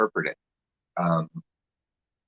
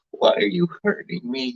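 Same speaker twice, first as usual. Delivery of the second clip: pleading victim; 12 semitones higher than usual. Retold or imitated. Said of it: "you just go".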